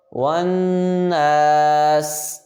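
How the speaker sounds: والناس with rounded lips on و and a held nasal نّ; rounded lips and ghunna. Shown as a demonstration. Teacher showing the students ghunna, slowly and drawn out. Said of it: "ون اور نون پہ غنہ ہوگا".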